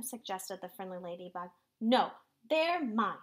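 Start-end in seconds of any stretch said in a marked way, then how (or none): 1.8-3.2 slow, loud, possessive outburst building higher; acted grouchy yell; storybook scream. A narrator's reading. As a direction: claim it greedily, getting louder on the last words. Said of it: "screamed the ladybug".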